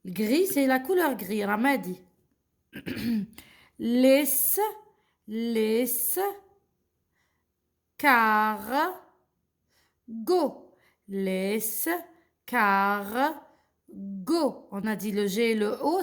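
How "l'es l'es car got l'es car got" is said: long, separate "Laisse", "car", "go"; hard G in "go", slow teacher delivery; syllable-by-syllable reading. Shown as a demonstration. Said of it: "c'est go".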